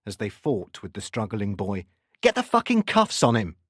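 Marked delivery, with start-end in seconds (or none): 2.2-3.5 high, forceful shouted command; urgent order. A narrator's reading. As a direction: order officers mid-struggle. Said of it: "Harvey ordered".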